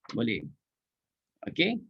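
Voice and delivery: ordinary talk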